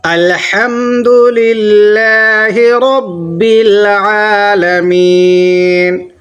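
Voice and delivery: slow melodic tajweed recitation; measured chanted recitation; Quran recitation. Shown as a demonstration. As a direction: recite slowly, drawing out each syllable. Teacher recites Quran slowly.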